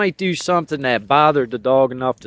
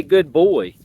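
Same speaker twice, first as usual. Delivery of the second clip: put-on forced good boy; 4 dB louder than usual. Shown as a demonstration. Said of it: "I gotta grit my teeth and say".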